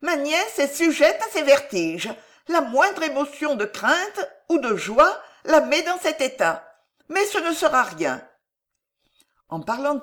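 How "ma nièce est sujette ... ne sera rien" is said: put-on high-pitched old woman's voice; reassuring old aunt. A narrator's reading. Old aunt explains her niece's faint, high reassuring voice.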